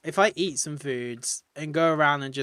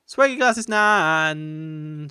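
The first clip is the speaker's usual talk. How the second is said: slow, drawn-out grand announcement; announcer; 5 dB louder, much slower than usual. Acted. Streamer announcing the grandma villager, slow drawn-out. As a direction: stretch every word like a showman.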